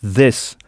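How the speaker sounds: this with the th said tongue between the teeth; this, lisped th sound; la langue entre les dents. Shown as a demonstration. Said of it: "avec la langue entre les dents".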